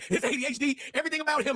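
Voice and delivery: ordinary delivery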